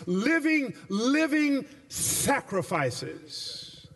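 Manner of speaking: "living" repeated, the second "living sacrifices" drawn out very slowly; stress on living. Shown as a demonstration. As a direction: lean on "living" twice, stretching "living sacrifices". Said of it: "He uses an oxymoronic phrase".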